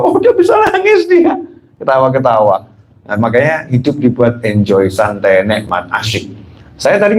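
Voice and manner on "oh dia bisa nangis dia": very high, laughing mock-delighted squeal; parents laughing. Parents laughing at their crying baby, high mock delight. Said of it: "Orang tua kita tambah ketawa-ketawa".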